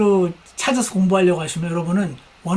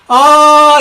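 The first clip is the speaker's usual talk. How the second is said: a loud, drawn-out, higher "ah" to drown out words; loud long ah; 7 semitones higher, 11 dB louder than usual. Shown as a demonstration. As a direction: hold a loud "ah" so you hear nothing. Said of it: "이래야지 안 들린단 말입니다".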